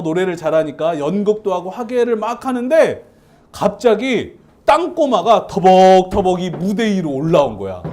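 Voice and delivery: ordinary delivery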